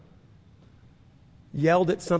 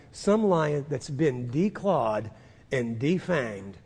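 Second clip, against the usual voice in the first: usual speaking voice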